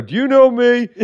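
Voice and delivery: high-pitched mock heckler calling out; hammed-up high heckler voice; imitated drunken heckler. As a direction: pipe up like a drunk from the back. Imitates drunk voice heard from corner.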